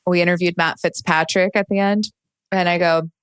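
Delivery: ordinary talk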